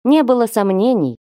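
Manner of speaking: ordinary delivery, nothing marked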